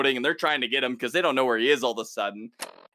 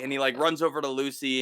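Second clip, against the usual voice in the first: ordinary delivery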